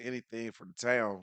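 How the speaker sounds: ordinary talk, nothing marked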